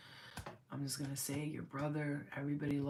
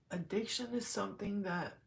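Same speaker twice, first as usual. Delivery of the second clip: real welling emotion, higher and halting; getting emotional; 3 semitones higher, slower than usual. Real, not acted. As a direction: struggle to get the thought out. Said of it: "I'm getting emotional".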